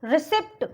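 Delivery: रिसिप्ट said with the P sounded; रिसिप्ट as ri-sept; mispronunciation demo. Shown as a demonstration. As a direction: wrongly voice pt at the end of रिसिप्ट. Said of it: "नहीं बोलेंगे".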